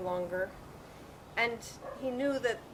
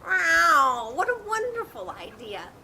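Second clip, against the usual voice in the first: delighted cat; 6 semitones higher, 7 dB louder, slower than usual. Acted.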